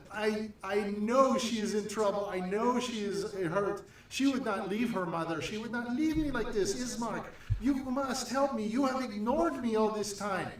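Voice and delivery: high, pleading and tearful; tearful mother. Acted. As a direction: beg for help through tears. Mary pleads with Ismark.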